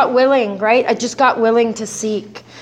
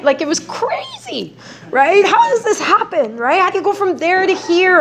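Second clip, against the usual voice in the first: higher, wondering disbelief; amazed; 9 semitones higher than usual. Real, not acted. Telling the audience about her own office, amazed. Marveling at her sudden success.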